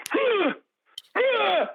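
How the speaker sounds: very high-pitched drawn-out mock screams; imitated screaming. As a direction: scream as if drowning out everyone on the call. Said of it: "literally starts screaming over everybody".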